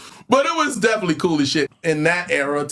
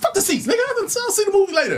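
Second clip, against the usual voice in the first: higher, fast brush-off; dismissive retort; 12 semitones higher, faster than usual. Retold or imitated. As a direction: wave him off quickly and cockily. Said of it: "I was like".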